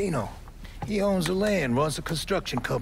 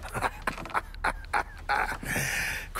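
breathy laugh; 2 semitones higher, 4 dB quieter than usual. Acted. Laughs on learning who he is.

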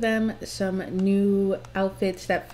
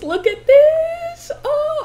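thrilled squeal; 6 dB louder, slower than usual. Real, not acted. Delighted squeal at the screen.

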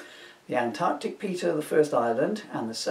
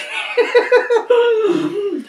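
high giggle fit; 9 dB louder than usual. Real, not acted.

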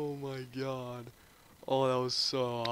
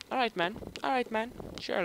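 All right, man — much higher; high-pitched; 10 semitones higher, faster than usual. Shown as a demonstration.

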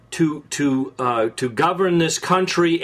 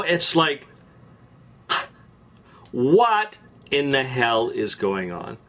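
usual speaking voice

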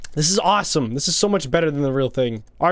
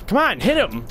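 normal talking voice

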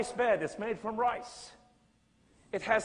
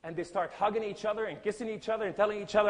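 ordinary delivery

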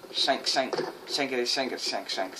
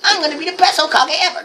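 high, loud mock-heroic ninja cry; put-on shinobi voice; 9 dB louder than usual. Gamer playing a ninja, put-on shinobi voice. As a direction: declare it as an over-the-top young ninja. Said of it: "Can't do that voice no more".

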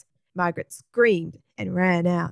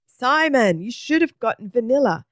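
mum calling after him, higher and louder; 5 semitones higher, 4 dB louder than usual. A narrator's reading. Mum to Simon as he runs back, calling out. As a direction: call out after a running child. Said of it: "his mum calling out behind him".

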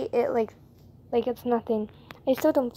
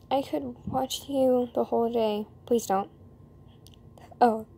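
usual speaking voice

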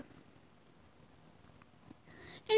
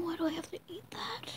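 fast hushed whisper; 3 semitones higher, 14 dB quieter, faster than usual. Real, not acted. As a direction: whisper it quickly in dread. Dreading the sour scoop.

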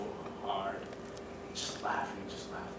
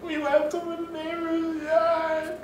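much higher, slow, whimpering confession; mock wailing; slower than usual. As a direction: kids confessing to grandma. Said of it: "we started bawling and crying our eyes out".

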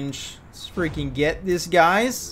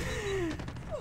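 soft high laugh; 12 dB quieter than usual. Real, not acted. Laughing after dying.

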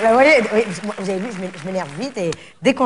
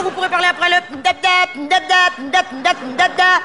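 high sung dap dap like a beep; mock beep noises; 8 semitones higher than usual. Shown as a demonstration. Mimicking the voicemail beep for the greeting, high and chanted. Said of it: "je vais faire un truc plus rigolo".

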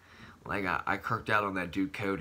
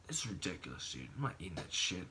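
whispered aside; 4 semitones lower, 11 dB quieter, faster than usual. Retold or imitated. Mock inner voice refusing food.

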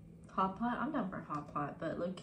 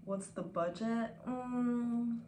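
ordinary talk

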